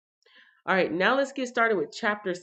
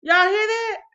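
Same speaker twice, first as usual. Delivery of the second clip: startled; 14 semitones higher, 8 dB louder than usual. Real, not acted. Startled by her cats fighting.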